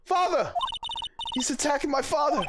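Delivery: put-on boy and men fighting over air in a dark elevator, panicked; panicked trapped characters. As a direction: scream for help in a sealed lift. Voices elevator scene characters.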